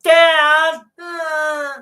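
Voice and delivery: squeaky mock child calling dad; mock kid voice. Dad imitating his bratty son, high kid voice. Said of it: "He'd be like".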